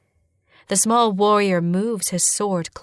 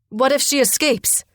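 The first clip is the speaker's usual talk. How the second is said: high-pitched anxious question; urgent challenge; 6 semitones higher, 4 dB louder than usual. A narrator's reading. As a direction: challenge them with pitch raised. Brother challenges the captors.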